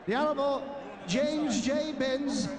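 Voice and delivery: normal talking voice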